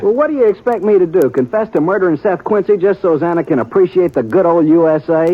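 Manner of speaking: defensive outburst. Acted. Heated argument over the murder.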